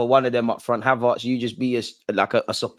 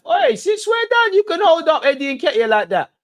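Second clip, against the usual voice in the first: outraged shout; 6 dB louder than usual. Real, not acted. Fan watching Arsenal yells at the ref in outrage. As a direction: bellow it in disbelief at the foul. Ref lets defender grab striker.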